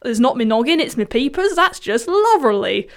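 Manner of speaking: imitating a cartoon boy's Cockney, lightly done; Cockney accent. Joking impression of a Simpsons meme, in a light Cockney accent. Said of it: "in a Cockney accent".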